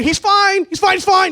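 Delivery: high, loud, hurried insistence; frantic reassurance. Acted. Speaker reassuring worried Alana about Keith, frantic and high.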